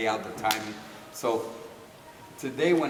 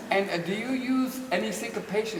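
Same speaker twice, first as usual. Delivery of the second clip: quizzing dancer; 8 semitones higher than usual. Retold or imitated. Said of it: "asking me all these theoretical questions".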